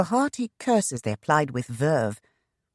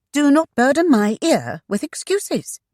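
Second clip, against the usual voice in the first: loud, high, sharp retort; 12 semitones higher, 7 dB louder than usual. A narrator's reading. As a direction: cut him off sharply. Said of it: "she returned sharply".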